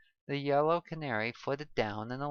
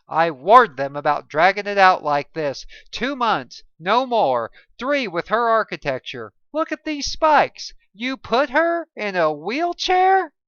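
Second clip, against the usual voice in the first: loud, raised indignant rant, pitch climbing; furious doctor berating staff over the patient; angry scolding; 7 semitones higher, 14 dB louder than usual. Acted. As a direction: tear into them for botching it. Doctor criticizing friend's treatment.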